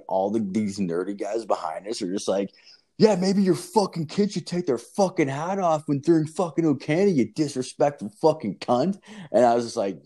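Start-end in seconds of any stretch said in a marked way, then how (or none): 3.0-9.0 high, worked-up mock rant; imitated angry retort. Nerdy guys fire back at the dad, imitated high angry rant. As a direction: hurl back a high-pitched, indignant tirade.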